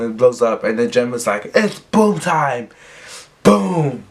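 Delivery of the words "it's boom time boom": higher, stretched-out mock yell; acted-out command, slow and punchy; hammy yell with a booming finish; yelled battle cry. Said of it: "I'll yell boom time".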